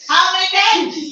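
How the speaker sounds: high, loud, drawn-out scolding; slow it down and raise it in exasperation; exasperated shout. Real, not acted. Teacher scolding students for mistakes.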